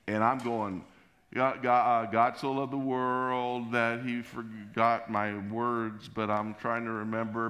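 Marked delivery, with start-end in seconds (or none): 1.3-7.5 slow, drawn-out, stuck preaching; halting, raised, stretched-out delivery; flustered struggling recital. Retold or imitated. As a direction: drag the words out while losing the thread. Preacher struggles on over the noise.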